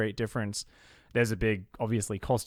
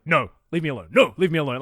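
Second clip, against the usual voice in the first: louder second take, mock growl at hawkers; gruff, rising irritation, a half-mock growl; growled refusal; 4 semitones higher, 8 dB louder than usual. Swatting off street touts, a mock growl. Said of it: "start growling at people".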